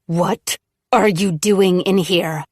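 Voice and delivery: slow, trembling angry demand; a slow demand that shakes with anger; shaking with anger. Acted. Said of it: "her voice shaking with anger".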